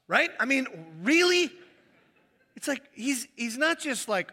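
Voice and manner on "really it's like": ordinary talk, nothing marked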